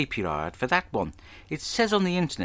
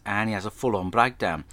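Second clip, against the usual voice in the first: ordinary delivery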